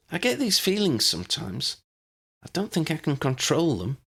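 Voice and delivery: put-on a worried girl unsure of her own powers; young Lydia.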